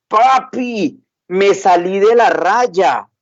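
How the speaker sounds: put-on distressed little girl. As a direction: plead it like a worried small girl.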